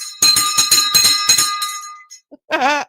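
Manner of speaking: high, loud burst of laughter; giddy laugh. Real, not acted. After ringing the big bell.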